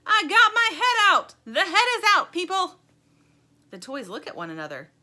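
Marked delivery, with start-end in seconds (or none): 0.0-2.8 very high, very loud bugling yell; bugle-like yell. A narrator's reading. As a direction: blare it out like a bugle. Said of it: "a voice like a bugle yells".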